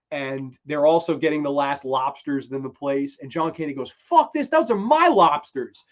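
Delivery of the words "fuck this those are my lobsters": high, loud indignant outburst; mock outrage. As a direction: shout it like a cheated diner, acting. Furious customer acted out.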